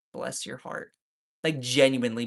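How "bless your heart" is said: whispered, soft, sweetly condescending; Southern put-down. Retold or imitated. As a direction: breathe it like a Southern aunt pitying you.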